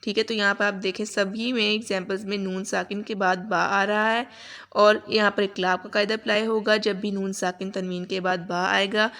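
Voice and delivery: usual speaking voice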